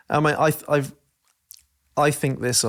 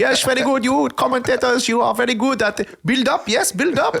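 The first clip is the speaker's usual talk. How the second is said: much higher, louder exaggerated Dutch accent; Ten Hag in Dutch accent; 13 semitones higher, 3 dB louder than usual. Manager mocking commentators' build-up, exaggerated high Dutch accent. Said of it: "But he doesn't sound like that".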